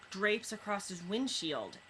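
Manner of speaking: usual speaking voice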